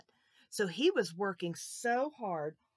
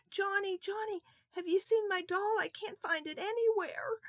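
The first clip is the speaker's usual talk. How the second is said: crying; 12 semitones higher, 4 dB louder than usual. Acted. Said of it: "She was crying".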